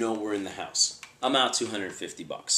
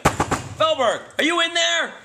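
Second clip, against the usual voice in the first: loud call at the trunk, pitched way up; shouting; 6 dB louder, slower than usual. Retold or imitated. Bounty hunter yelling into a car trunk, loud call. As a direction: yell through the car trunk.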